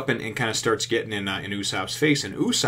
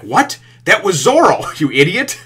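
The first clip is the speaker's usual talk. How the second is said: higher, louder outraged shout; exasperated yell; 9 semitones higher, 11 dB louder than usual. Retold or imitated. Arlong yells at Hachi for helping Zoro, outraged shout. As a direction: blow up at the fool with a laugh.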